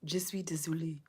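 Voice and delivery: a soft, toneless whisper of "Je suis désolé"; murmuring the French under her breath; whispered practice. Real, not acted. Practising French phrase to herself.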